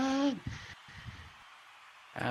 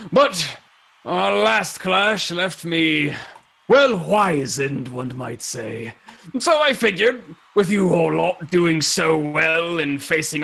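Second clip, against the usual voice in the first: slow, theatrical veteran voice; drawn-out, weighty warrior's counsel; reminiscing old champion; 2 semitones higher, much slower than usual. Acted. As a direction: recall old battles with relish.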